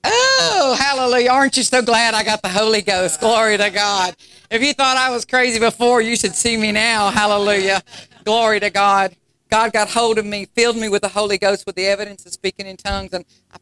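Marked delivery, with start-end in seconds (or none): 0.1-11.0 loud, ringing praise shouts with a cry in the voice; full-force preaching. Acted. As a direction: lift it up and shout for joy like a preacher on fire.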